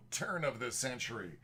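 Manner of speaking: big movie-trailer announcer read; projected movie voice. Acted. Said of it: "projecting the movie voice".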